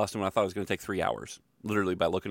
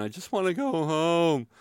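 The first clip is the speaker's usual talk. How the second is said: higher, drawn-out homesick moan; mock whining; 7 semitones higher than usual. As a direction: wail it half-jokingly, stretching the end. Repeats the mock tearful refrain.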